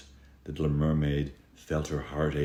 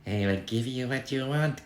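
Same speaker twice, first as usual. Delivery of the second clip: higher, sly witch voice; wicked sea witch, higher pitch; cackling sea witch; 8 semitones higher than usual. Acted. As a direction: tempt her like a witch making a deal. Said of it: "cackled the witch".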